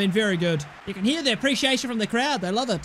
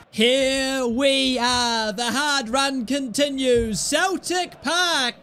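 dramatic announcer intro; much slower than usual. Acted.

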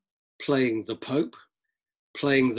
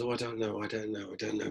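dithering; 2 semitones lower, 9 dB quieter, faster than usual. Acted. Lecturer plays the Pope before he has his robes, dithering. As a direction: mumble it softly, as if undecided.

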